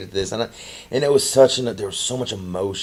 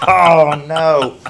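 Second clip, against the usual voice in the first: mock shocked exclaim; 6 semitones higher, 11 dB louder than usual. Retold reaction to friend's remark.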